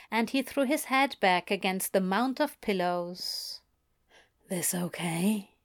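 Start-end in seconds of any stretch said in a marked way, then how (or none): 4.5-5.6 softer, slower tender question; gentle check. A narrator's reading. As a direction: ask gently and slowly.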